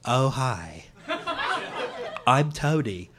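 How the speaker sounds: low, deadpan dog voice; simple dog introducing himself, pitched low; Tony. Acted. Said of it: "his voice is this".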